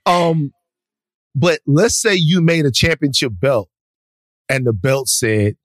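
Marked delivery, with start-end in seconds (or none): none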